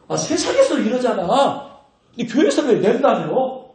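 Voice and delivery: usual speaking voice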